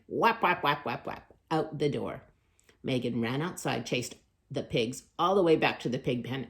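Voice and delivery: ordinary delivery, nothing marked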